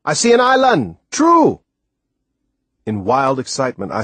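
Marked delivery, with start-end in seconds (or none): 0.0-1.6 high, loud, thrilled cry of discovery; excited shout. Acted. Timothy spots land and shouts to the boy, high excited shout. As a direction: yell it out, overjoyed. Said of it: "I heard Timothy shout".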